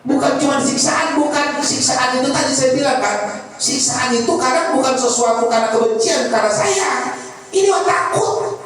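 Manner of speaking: high-pitched sermon voice dwelling on fear; fervent preaching. Acted. Preacher acting out fear of Allah's anger, fervent preaching. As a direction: keep the pitch up and press each "takut".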